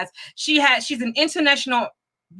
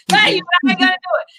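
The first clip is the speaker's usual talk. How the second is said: laughing; 6 semitones higher, faster than usual. Real, not acted. Laughing aside after fake apology.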